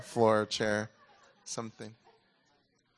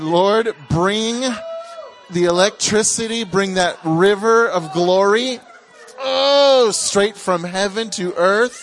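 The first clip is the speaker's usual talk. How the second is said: preaching at full force, louder and higher, slow and drawn out; fervent prayer; 7 semitones higher, 9 dB louder, slower than usual. Real, not acted. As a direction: cry out to heaven for the glory to fall. Pastor prays over the people.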